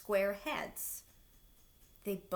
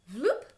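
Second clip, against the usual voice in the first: loud rising vloop; stretchy sound effect; 6 semitones higher, 9 dB louder than usual. A narrator's reading. Blob shooting out arms, rising sound effect. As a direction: pop it out like goo stretching.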